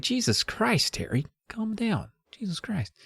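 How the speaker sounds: mock exasperated hush. [name] hushing his excited sister.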